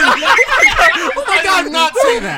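high, loud laughing denial; real laughing denial; laughing protest. Real, not acted.

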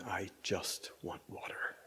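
a hushed whisper, like a private inner voice; whispered thought. Acted. Jesus on the cross silently thinking, whispered. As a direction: breathe it as an unspoken thought. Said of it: "What might he have been thinking?".

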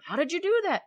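astonished at what she pulled off; amazed question. Retold or imitated. Engineers asking the woman who fixed the machine, amazed. Men amazed at her results.

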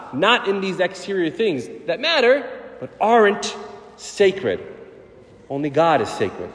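ordinary delivery

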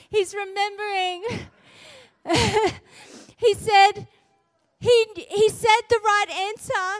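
real tearful voice, high and strained; choked up. Real, not acted. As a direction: push the words out through tears. Chokes up retelling son's tears.

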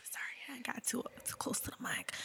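very soft whispered aside; hushed apology. Real, not acted. Apologising for getting too close to the mic, hushed.

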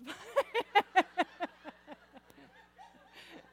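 long, high burst of laughing; laughing at the dog; real laughter. Real, not acted. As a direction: laugh helplessly.

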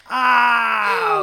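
loud pained cry of horror. Real, not acted. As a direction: cry out at the sad scene. Reacting to hunter scene.

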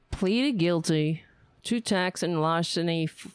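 ordinary talk

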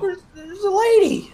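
high-pitched, stammering mock excitement; mock frantic call. Co-host voicing man alerting buddies, mock frantic call. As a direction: squeal the news up high.

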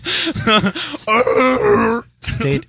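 high, stuttering wolf voice; werewolf. Acted. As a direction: yelp it like a wolf. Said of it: "That's my wolf impression".

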